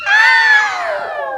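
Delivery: very high, loud squeal of the sound; really high voice. Shown as a demonstration. Teacher shows the class a really high voice. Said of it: "Say it in a really high voice".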